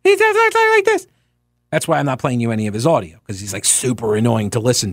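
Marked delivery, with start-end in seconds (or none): 0.0-1.1 squeaky, very high mocking voice; Philip Bump impression. Shown as a demonstration. Host showing listeners how the columnist sounds, high mocking impression. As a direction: demonstrate his voice as a high caricature. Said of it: "that's my Philip Bump impression".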